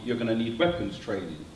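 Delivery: usual speaking voice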